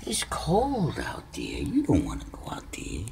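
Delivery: soft whisper to the cat. Real, not acted. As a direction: whisper it gently to keep him in. Coaxing cat to stay inside.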